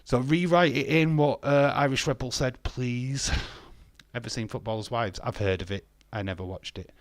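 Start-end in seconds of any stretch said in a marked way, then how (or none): none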